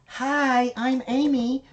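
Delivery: high, bright greeting in Amy's voice; Amy impression. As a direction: pitch up to play Amy saying hi. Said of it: "do an Amy impersonation".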